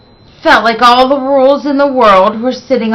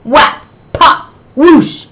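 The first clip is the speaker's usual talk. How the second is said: sound effects; 6 semitones higher, 4 dB louder than usual. A narrator's reading. Hair explosion sound effects.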